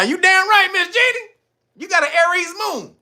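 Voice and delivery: high loud hearty agreement; excited loud agreement. Real, not acted. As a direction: call it out with delight. Host answers caller loudly.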